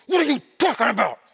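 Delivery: clenched-jaw angry words; through gritted teeth. Shown as a demonstration. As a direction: speak without opening the teeth. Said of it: "speak with, through their teeth".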